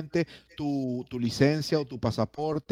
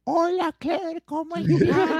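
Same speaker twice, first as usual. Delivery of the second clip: very high playful falsetto greeting; high squeaky voice; 4 dB louder, much slower than usual. Pretend. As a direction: squeak it out, laughing.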